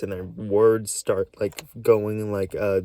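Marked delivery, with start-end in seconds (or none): none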